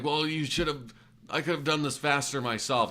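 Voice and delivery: threatening customer. Retold or imitated. Customer griping at the mechanic about speed, mock indignant. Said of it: "acting threateningly".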